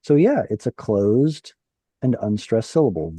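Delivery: ordinary talk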